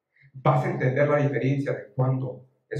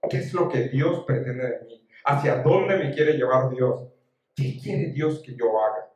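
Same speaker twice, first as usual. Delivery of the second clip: usual speaking voice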